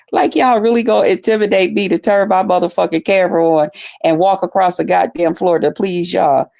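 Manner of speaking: ordinary talk, nothing marked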